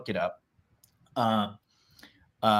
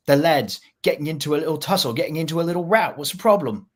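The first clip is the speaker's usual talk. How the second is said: higher-pitched London lad; Cockney accent; 5 semitones higher, faster than usual. Acted. Explaining the lads' little tussles, in a Cockney accent. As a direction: play it as a breezy Cockney lad. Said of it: "Less Cockney".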